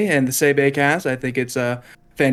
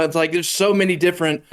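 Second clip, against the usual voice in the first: ordinary talk, nothing marked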